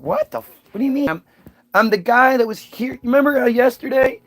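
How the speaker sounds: high fast offended protest; outraged nobody remembers you; high disbelieving protest; indignant. Pretend. Indignant at being ignored.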